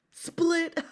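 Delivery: high loud gleeful punchline; shouted punchline. Acted. Uncle delivering his joke's punchline, high and loud. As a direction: bellow the punchline with glee.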